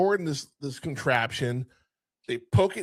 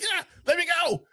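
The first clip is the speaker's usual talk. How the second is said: panicked yelp; faster than usual. Retold or imitated. Kid squirming in the pot.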